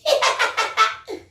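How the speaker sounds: high teasing giggle; a bright mocking laugh; girlish laugh. A narrator's reading. Little sister laughs at her brother's planet story. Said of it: "said with a laugh".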